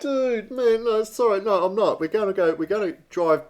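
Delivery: squealing, laughing no; exasperated protest. Real, not acted. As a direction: cut him off in mock outrage. Protesting co-host's hard story.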